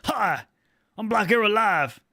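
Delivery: lightly voiced odd caller greeting; put-on caller voice. Streamer imitates friend calling in live, put-on voice. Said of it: "I don't know why I talk like that".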